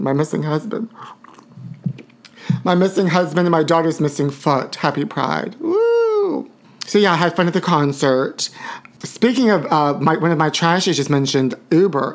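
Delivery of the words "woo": very high, sustained woo; high "woo" cheer. Real, not acted. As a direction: let out a long high-pitched whoop. Cheer after Happy Pride.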